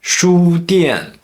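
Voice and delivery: 书店 with 书 high and level, 店 falling sharply; first then fourth tone. Shown as a demonstration. Teacher demonstrating mixed tones, 书店 high-level then falling. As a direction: hold 书 high, then drop 店. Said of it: "First tone, then fourth tone".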